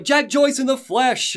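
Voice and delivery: put-on high, drawn-out hailing of an old friend; acted, stretched-out welcome; delighted greeting. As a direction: greet him with a big flourish.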